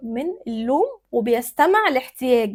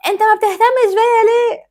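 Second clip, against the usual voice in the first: loud, high-pitched demand; nagging complaint; 9 semitones higher, 8 dB louder than usual. Acted. Wife scolding her husband for neglect, loud nagging. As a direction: yell it as an accusing question. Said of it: "الطريقة دي وحشة".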